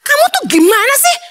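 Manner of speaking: high, fast, whiny complaint; whiny wife scolding. Retold or imitated. Wife scolding husband over an unflattering photo, high fast whine. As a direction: nag like a wife upset by a bad photo.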